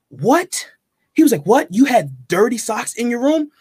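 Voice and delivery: high, incredulous "What?"; lightly voiced boss's outraged disbelief; mock shocked manager. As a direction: play the boss appalled on her behalf. Manager shocked at guest's complaint.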